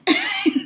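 short high-pitched laugh; quick amused laugh. Real, not acted. Recalling clerks stalling with the letter, amused laugh.

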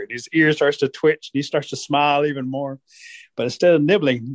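ordinary talk

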